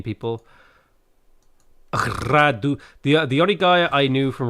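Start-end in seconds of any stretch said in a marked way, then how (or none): 1.9-2.8 imitated Moldovan accent; rolled R in a Moldovan accent; Radu. Streamer recalling a guy from Moldova, imitated accent. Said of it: "he talked like Radu".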